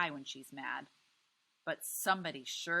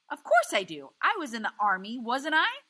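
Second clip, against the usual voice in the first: louder, higher, stubborn husband; husband defending his plan to his wife, raised voice; 8 semitones higher, 13 dB louder than usual. A narrator's reading. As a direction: shout back defensively. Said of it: "Grim's voice isn't nearly as loud".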